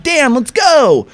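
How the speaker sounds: high, slow, urgent call; mock panicked call to a friend; calling Dan away from a knife, hammed-up fear; urgent plea. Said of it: "And I'm like".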